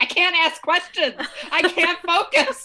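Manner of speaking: high, loud mock exasperation; retold pissed-off outburst. Guest star stirring soup by hand, imitated angry exasperation. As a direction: imitate a fed-up wail, laughter around it. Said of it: "she's just so pissed off".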